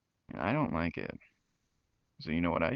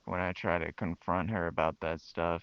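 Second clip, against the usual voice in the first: usual speaking voice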